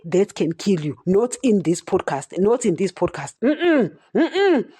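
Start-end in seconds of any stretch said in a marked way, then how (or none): none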